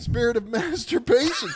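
amused repeat, breaking into laughter; laughing. Real, not acted. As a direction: say it through a laugh. Amused repeat of co-host's joke.

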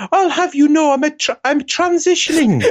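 hammed-up falsetto retort; indignant lady voice. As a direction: huff it out as the fake wife. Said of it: "doesn't sound like a woman's voice".

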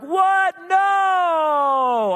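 high, drawn-out mock scream; hammed-up childish outcry; imitated kids' wail. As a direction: wail it like devastated kids, very loud. Kids react to losing the cat.

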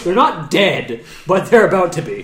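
slow, loud and high gloating; gleeful threat. Acted. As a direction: relish the coming kill. Gleeful threat over enemies.

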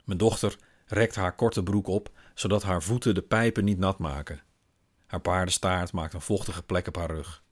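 plain reading voice

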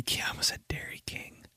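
near-whisper at own slip; very soft, to yourself; hushed aside. Real, not acted. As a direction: drop to a murmur, catching yourself. Catches own slip, drops to whisper.

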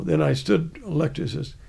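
normal talking voice

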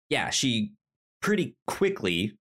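ordinary talk, nothing marked

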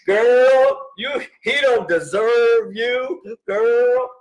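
very high, loud, drawn-out outrage; squealed mock indignation, stretching the words; hammed-up girlfriends. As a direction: imitate sassy girlfriends hyping her up. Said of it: "all the girls are like".

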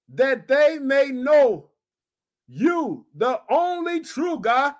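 slow, drawn-out reading with long pauses; solemn scripture reading. Shown as a demonstration. Pastor reading John seventeen aloud, slow and solemn. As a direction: lay out each phrase of scripture slowly. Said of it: "I want you to see verse three".

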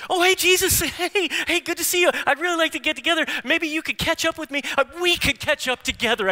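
high, loud, mock-friendly holler; hammed-up shouted chumminess; overeager greeting. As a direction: gush hello while rushing right past. Acts hurried person greeting Jesus.

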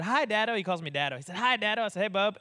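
ordinary talk, nothing marked

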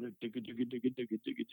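rhythmic digga digga mimicking a muted guitar; guitar riff vocalized. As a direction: chug out the riff with your voice. Imitating his guitar riff.

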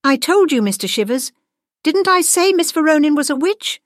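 high, triumphant I-told-you-so; vindicated exclamation. A narrator's reading. Gwendolyn proven right to Mister Shivers, high and triumphant. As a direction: crow at being proven right, pitched high.

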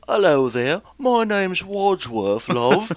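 high, stretched, cheesy British charm; overdone English accent. Shown as a demonstration. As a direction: ham up a flirty English greeting, slow. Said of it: "I'm not gonna do that one".

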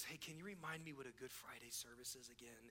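very hushed, low-pitched imitated whisper; retold whisper. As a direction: lean in and murmur it, barely audible. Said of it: "he whispers in my ear".

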